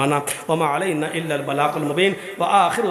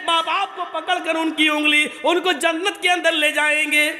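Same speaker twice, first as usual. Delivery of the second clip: raised, fervent sermon storytelling voice; impassioned preaching; 12 semitones higher than usual. Acted. Preacher telling the congregation of children in paradise, fervent preaching. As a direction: preach it with rising fervour to the crowd.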